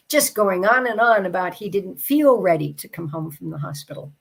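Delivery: ordinary talk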